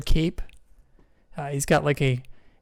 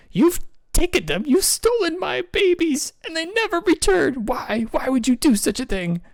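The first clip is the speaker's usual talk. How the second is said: grieving penguin queen; 13 semitones higher than usual. Acted. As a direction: wail it as a mother robbed of her babies. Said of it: "she goes".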